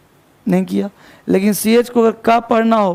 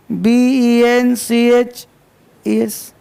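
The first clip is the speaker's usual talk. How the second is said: spelled out slowly; much slower than usual. Shown as a demonstration. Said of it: "अब बनाते हैं plural".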